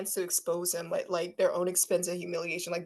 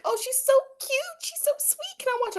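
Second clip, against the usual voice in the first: put-on much higher, cooing over a cute kid; gushing fan voice. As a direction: coo like a smitten stranger. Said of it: "they're like".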